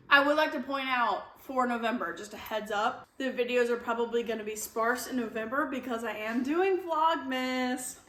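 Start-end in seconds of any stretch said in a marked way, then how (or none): none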